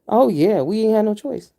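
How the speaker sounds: ordinary talk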